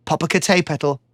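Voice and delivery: ordinary delivery, nothing marked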